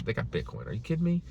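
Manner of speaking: ordinary talk, nothing marked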